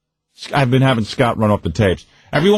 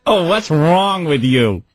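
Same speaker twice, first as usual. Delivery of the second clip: slow, higher-pitched drawn-out reproach; nagging mother; 4 semitones higher, much slower than usual. Retold or imitated. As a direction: imitate a mother piling on. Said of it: "My mother".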